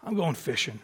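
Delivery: low, very soft murmur; resigned. Acted. Peter returns to his old life, resigned. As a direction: let it sink quietly, giving up. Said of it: "I almost wonder if he was just".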